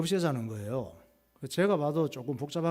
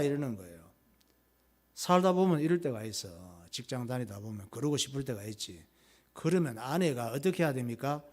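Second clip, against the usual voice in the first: normal talking voice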